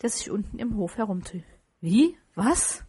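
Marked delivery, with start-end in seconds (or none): 1.8-2.8 two sharp, rising questions; astonished boy, pitch shooting upward; utter astonishment. A narrator's reading. Boy answers Scrooge's odd question, utterly astonished. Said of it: "gab der Junge in höchstem Erstaunen zurück".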